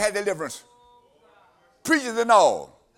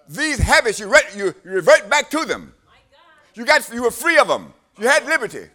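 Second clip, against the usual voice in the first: louder, higher preacher's cry, driving each line home; full-force preaching; 5 semitones higher, 8 dB louder than usual. Acted. Preacher warning the congregation about bondage, full-force preaching. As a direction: preach it hard and rising to the congregation.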